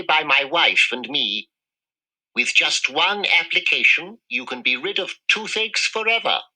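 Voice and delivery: mouse dentist voice. Acted. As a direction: pitch it like a kindly salesman. Dentist's pitch voice.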